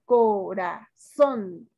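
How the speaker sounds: "corazón" in slow syllables, ending on a marked ZÓN; stress on ZÓN. Shown as a demonstration. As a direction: split up "corazón" and land firmly on "zón". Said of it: "Su sílaba tónica es son". ("corazón" sílaba por sílaba, marcando ZÓN)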